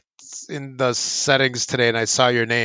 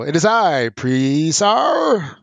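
grand, high villain announcing himself; loud drawn-out proclamation, stretching the name Presar; hammed-up grand entrance, name held long and high; Chaos; 5 semitones higher, 3 dB louder, much slower than usual. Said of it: "like Chaos".

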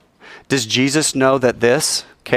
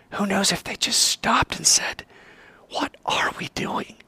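hushed, voiceless wondering; soft whispered second thoughts; 10 semitones higher, 5 dB quieter than usual. Retold or imitated. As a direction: whisper it like a private doubt. People quietly questioning themselves.

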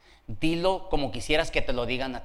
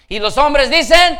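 loud, higher call for a response; shouted call; 10 semitones higher, 11 dB louder than usual. Real, not acted. Preacher prompting the men for an amen, shouted call. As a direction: call out, rousing the room.

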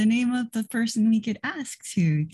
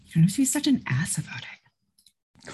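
whisper. Acted. Aside to other player character.

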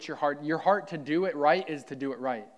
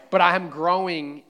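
emphatic declaration; 3 semitones higher, 10 dB louder than usual. Real, not acted. Preacher affirming his growth.

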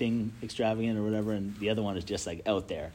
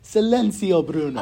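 bellowed higher and louder, Italian-ish Silenzio; loud Italian shout; 9 semitones higher, 12 dB louder than usual. Retold or imitated. Friends hear her yell the Italian movie line loudly. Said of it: "they're Italian, so they say".

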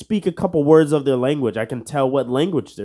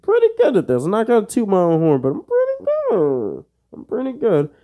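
mock boast; 9 semitones higher than usual. Echoes his own boast in a put-on voice.

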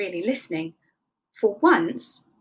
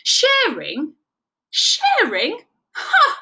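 high, loud incredulous echo; outraged rabbit scoffing; scornful disbelief; 10 semitones higher, 5 dB louder, much slower than usual. A narrator's reading. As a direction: scoff louder and higher each time.

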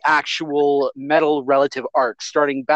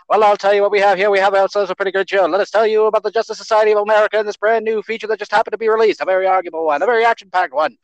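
put-on fast, higher old-time radio announcer; 1950s announcer; 4 semitones higher, faster than usual. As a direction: rattle it off like a newsreel host. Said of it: "nineteen fifties announcer [name]?".

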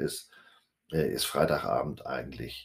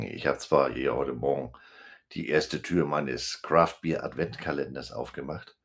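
usual speaking voice

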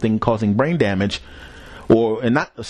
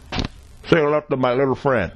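Tony Montana impression; 3 semitones higher than usual. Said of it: "almost got a Tony Montana accent".

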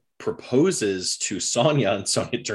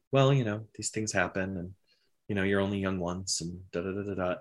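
soft, resigned mumble under the breath; muttering to himself; 3 semitones lower, 9 dB quieter, faster than usual. Retold or imitated. Father Ilya mutters to himself after his son's gambling loss, a soft resigned mumble. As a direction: trail off, talking to yourself. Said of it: "you hear him sort of muttering".

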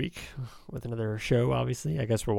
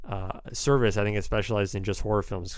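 ordinary delivery

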